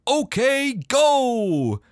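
high, loud, drawn-out battle cry; hammed-up game-start yell; Captain America. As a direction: belt it like an arcade announcer. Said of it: "Captain America yelling".

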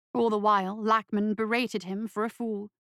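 ordinary delivery, nothing marked

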